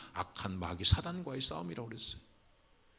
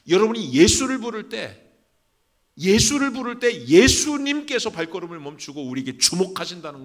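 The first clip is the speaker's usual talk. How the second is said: high, loud exhortation; full-force preaching; 7 semitones higher, 13 dB louder than usual. Real, not acted. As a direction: drive it home to the congregation at the top of your voice. (힘차게 외치는 설교)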